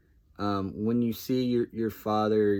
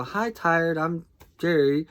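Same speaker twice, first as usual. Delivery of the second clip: high, loud joke hello; mock introduction; 9 semitones higher, 6 dB louder than usual. Answering someone who says they're tired, mock introduction. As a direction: greet them by the wrong name, pitched up. Acting his dad-joke greeting.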